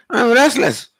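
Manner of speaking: the disciple pressing his complaint; mock-insistent student. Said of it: "Like this person says".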